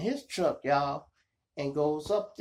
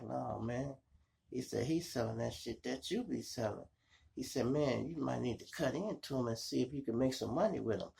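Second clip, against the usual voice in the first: quiet advice; 10 dB quieter than usual. Retold or imitated. Brother quietly talking him out of a fight, hushed. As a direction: talk him down gently.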